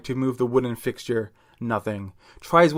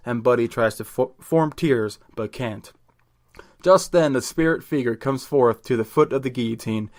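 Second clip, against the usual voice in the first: ordinary delivery, nothing marked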